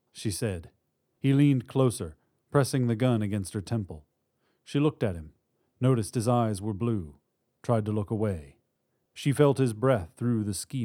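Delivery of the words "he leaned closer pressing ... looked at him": usual speaking voice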